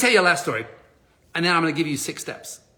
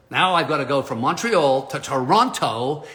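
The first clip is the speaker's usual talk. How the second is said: normal talking voice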